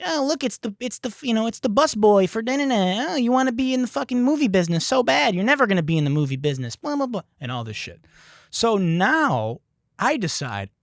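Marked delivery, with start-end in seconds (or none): 0.0-7.2 higher, sneering heckler voice, fast; mocking heckler. Imitating the chippy heckler mocking him, higher sneer. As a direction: taunt him like a smug dork. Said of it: "and he's like".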